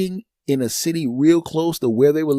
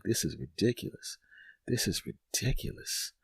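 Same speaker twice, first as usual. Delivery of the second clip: low, much softer muttering to himself; muttered aside; 5 semitones lower, 13 dB quieter than usual. Retold or imitated. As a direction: mutter it under your breath. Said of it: "I'm looking at them like".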